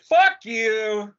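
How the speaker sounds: high, loud shouted demo. Shown as a demonstration. Podcaster with nobody living above, shouting loudly. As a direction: shout it out high, free to be noisy. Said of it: "so I can kinda talk loud".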